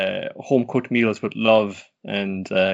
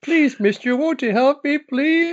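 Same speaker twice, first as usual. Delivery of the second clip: put-on much higher, louder begging child's voice; pleading little boy; 6 dB louder, slower than usual. The lost boy pleading with the detective for help, high and loud. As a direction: beg like a small kid who lost his dog.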